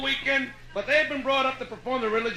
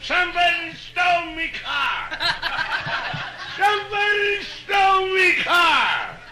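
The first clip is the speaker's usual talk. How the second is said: put-on slow, loud drunken bellow; drunken Irish shout; 5 semitones higher, 3 dB louder, slower than usual. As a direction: bellow it down the street at one in the morning. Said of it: "screaming to the top of his voice".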